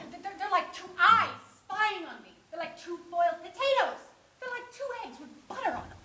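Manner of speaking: high, loud, escalating agitation; frantic, hammed-up dread; agitated fretting. Obsesses over two zeros.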